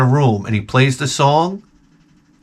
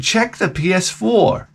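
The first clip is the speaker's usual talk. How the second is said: slow, slightly raised, measured order; solemn instruction; 4 semitones higher, slower than usual. Retold or imitated. Dad telling his son to look, solemn instruction. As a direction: say it slowly and portentously.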